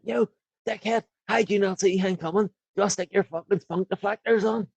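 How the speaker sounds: high-pitched, exasperated call to a player; mock angry heckle. Fan berating a player who missed his man, mock angry heckle. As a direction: have a go at him from the stands, pitched well up.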